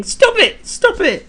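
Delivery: high squealing plea. Acted. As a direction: squeal it through laughter. Laughing teacher begs.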